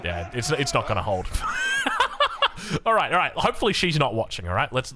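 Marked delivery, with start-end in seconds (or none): 1.3-2.8 high burst of laughter. Real, not acted. Laughs at his own fib.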